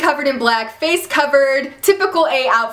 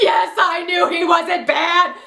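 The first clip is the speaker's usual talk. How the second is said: slow, higher, gleeful I-knew-it cry; excited yes; 5 semitones higher, slower than usual. Retold or imitated. Recapping Ezra's rescue, a gleeful told-you-so cry. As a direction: relive the moment she was proven right. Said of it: "I was like".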